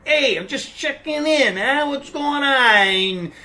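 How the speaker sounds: loud, high Italian-American voicemail with drawn-out words; caricatured Italian mobster-style phone message; over-the-top Italian. Retold or imitated. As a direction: ham up an Italian family friend leaving a message. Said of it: "so over the top Italian".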